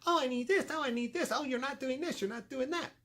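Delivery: high mocking whine of needy coworkers; mocking falsetto of complaining colleagues; whiny complainers. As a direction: mimic the moaning people, nasal-high and petty. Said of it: "bitch and moan and whine and complain".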